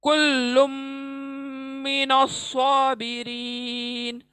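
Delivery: slow chant of कुल्लुम मिनस साबिरीन with heavy saad; tajweed recitation. Shown as a demonstration. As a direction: recite slowly, merge नून, heavy साद. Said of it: "पुर पढ़े जाने वाला इसका ध्यान रखते हुए अदा करें".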